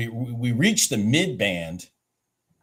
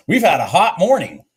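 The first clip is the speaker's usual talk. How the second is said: excited; 6 semitones higher, 8 dB louder than usual. Real, not acted. Repeats with laughing emphasis.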